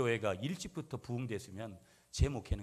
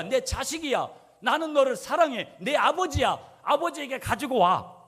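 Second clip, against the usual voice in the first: loud, high, insistent sermon delivery; preaching at full force; 12 semitones higher, 12 dB louder than usual. Acted. Pastor exhorting his congregation, preaching at full force. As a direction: drive it home to the pews.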